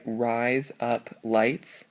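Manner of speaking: each word spaced out, slow; slow and separate. Shown as a demonstration. Teaching the memory trick, words said slowly apart. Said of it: "if you say".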